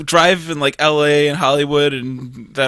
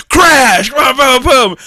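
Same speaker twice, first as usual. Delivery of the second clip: loud, raised-pitch booms; shouted crash effect; 7 semitones higher, 7 dB louder, much slower than usual. Retold or imitated. Comic retelling of a car crash, shouted impact noises. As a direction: act out the impact at full volume.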